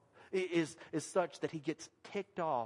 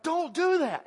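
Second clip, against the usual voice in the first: put-on sharp, higher and louder warning to the kid; warning shout; 11 semitones higher, 9 dB louder, slower than usual. Father shouting at his son reaching for the hot stove, sharp and loud. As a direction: stop him cold, loud and high. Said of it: "and I was like".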